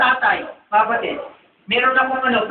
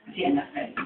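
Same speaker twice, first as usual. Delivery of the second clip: a soft unvoiced whisper; 9 dB quieter, much faster than usual. Real, not acted. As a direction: lean in and breathe it. Whispered aside to listener. (pabulong na sinabi)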